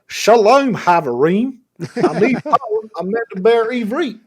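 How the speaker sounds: imitating a Louisiana Creole accent in Hebrew, high and loud; thick Creole accent. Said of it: "with a thick Creole accent speaking Hebrew".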